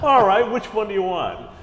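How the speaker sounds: high-pitched mock-obliging question; smart aleck. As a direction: needle her with a squeaky, teasing offer. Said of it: "smart aleck I am".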